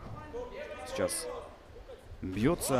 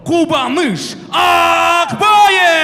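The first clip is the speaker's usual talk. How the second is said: drawn-out fighter name shout; 18 dB louder, much slower than usual. Acted. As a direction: stretch the name, huge and high.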